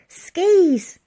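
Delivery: high, loud eureka; excited realisation. A narrator's reading. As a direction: blurt out the bright idea. Elsie's sudden idea.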